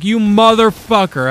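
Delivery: exasperated outburst. Acted. Narrator loses patience with the third stranger, mock furious. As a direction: snap at him, totally fed up. Angry at yet another mugger.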